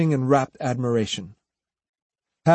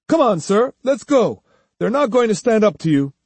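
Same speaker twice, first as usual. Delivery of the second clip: higher, jeering follower, hurrying at the end; dismissive urging; 8 semitones higher, 4 dB louder than usual. Acted. Follower urging his master to leave the cowards, dismissive. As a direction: urge the master off with open scorn.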